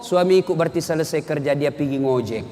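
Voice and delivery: a wife reassuring herself about her husband; calm trusting wife. Acted. Istri berprasangka baik pada suami. (istri yang tenang berprasangka baik)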